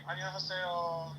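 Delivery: high, drawn-out Korean hello; mock Korean greeting. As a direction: imitate a North Korean greeter, slowly. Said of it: "they're gonna be like".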